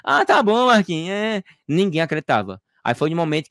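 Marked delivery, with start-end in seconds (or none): 0.0-1.4 high, sarcastic doubt; mock disbelief. Neighbours scoffing at Marquinhos, high sarcastic disbelief. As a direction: tease him as if he's lying, voice up high. People mocking his claim.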